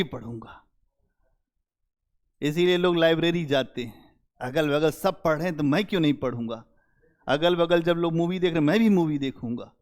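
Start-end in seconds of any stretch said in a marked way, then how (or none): 4.4-9.7 fast, offhand student thinking aloud; rushed inner voice. Retold or imitated. Teacher voicing a student who copies his neighbours, fast and offhand. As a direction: tumble through it quickly like a copycat's reasoning.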